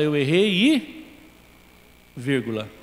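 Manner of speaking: slow hanging "e", then a low "vírgula"; trailing excuse. Retold or imitated. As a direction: stretch "Eu errei e" slowly, hanging rise on "e", then drop low on "vírgula". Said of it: "a gente fala".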